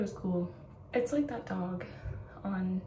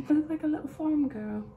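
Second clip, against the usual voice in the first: put-on a light country farm girl accent, a touch higher; 5 semitones higher, 8 dB louder than usual. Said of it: "I keep talking in an accent".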